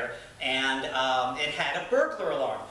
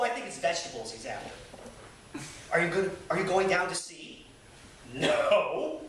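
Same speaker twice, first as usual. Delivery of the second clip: reading a memoir aloud with dry humor; lively, amused reading voice; story narration; 6 semitones higher than usual. Acted. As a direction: tell it like a fireside storyteller. Resumes reading the chapter.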